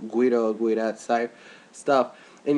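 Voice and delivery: ordinary delivery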